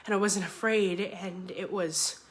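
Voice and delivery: normal talking voice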